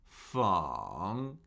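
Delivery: "feng" dipping low then rising; third tone. Shown as a demonstration. Teacher cycling four tones on a syllable, "feng" dipping. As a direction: let "feng" fall, then bring it back up. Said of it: "Let's do the four tones".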